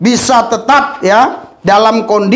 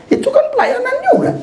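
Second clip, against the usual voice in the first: laughing quote; faster than usual. Retold or imitated. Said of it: "saya bilang begini".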